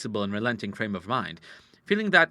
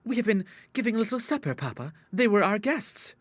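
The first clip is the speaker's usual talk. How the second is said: put-on much higher girlish voice; pleading daughter; 10 semitones higher than usual. A narrator's reading. Daughter explains the party to father, high and innocent.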